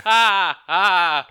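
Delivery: loud, high mock shout as spaghetti drops; hammed-up yell, mouth wide; playful shout. Mock spaghetti drop noise.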